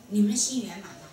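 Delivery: normal talking voice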